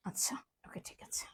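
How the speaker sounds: whispered aside to herself; hushed, unvoiced check before going live. Real, not acted. Muttering to herself before starting the live.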